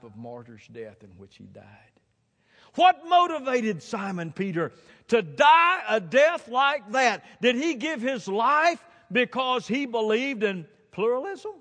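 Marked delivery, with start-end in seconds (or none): 2.7-11.5 high, loud challenge from the pulpit; impassioned preaching. Real, not acted. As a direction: fire the questions out at full force. Preaches at full force.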